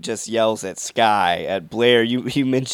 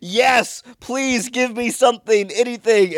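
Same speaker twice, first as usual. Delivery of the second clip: high, urgent begging for more; eager pleading; 11 semitones higher than usual. Retold or imitated. Viewer hanging on a reveal, excited pleading. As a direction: beg like a viewer on the edge of their seat. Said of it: "on the edge of my seat".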